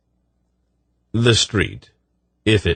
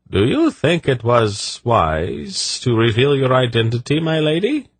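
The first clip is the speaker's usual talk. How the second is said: dubious; 6 semitones higher than usual. A narrator's reading. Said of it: "Tadi asked dubiously".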